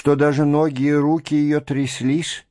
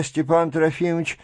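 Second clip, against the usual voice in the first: plain reading voice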